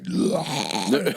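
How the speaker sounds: low, fed-up groan; exasperated groan. Retold or imitated. Wife groaning coming home.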